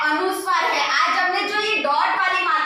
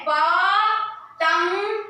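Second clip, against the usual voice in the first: drawn-out word; 2 semitones higher, much slower than usual. Shown as a demonstration. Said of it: "आपके text book में भी है".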